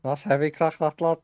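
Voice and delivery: put-on repeating the catchphrase in a Scouse accent; Paddy the Baddy. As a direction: Liverpool accent, cheeky fighter swagger. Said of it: "Fucking Paddy the Baddy?".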